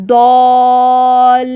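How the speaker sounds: ضال with the alif held very long and heavy; extra-long alif. Shown as a demonstration. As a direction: stretch the alif of ضال well out. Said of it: "اس کو تھوڑا ذرا زیادہ بڑا کریں". (ضال میں الف خوب لمبا)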